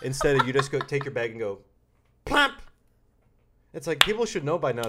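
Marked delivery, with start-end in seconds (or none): 2.2-2.6 high, loud plop; a big comic plop noise, much higher and louder; plop sound effect. Pretend. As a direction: smack the bag down with a high, loud plump. Sound of bag dropped.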